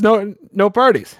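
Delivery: stern dad laying down the law, slowly; real serious tone. Acted. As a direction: lay down the rule in earnest. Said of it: "this real serious tone".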